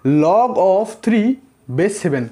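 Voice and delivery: very slow, every word stretched; slow demo reading. Shown as a demonstration. Teacher modelling the reading for students, very slowly. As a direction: read "log of three base seven" slowly and evenly. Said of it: "इस चीज़ को हम ऐसे करके पढ़ेंगे".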